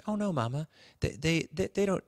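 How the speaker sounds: normal talking voice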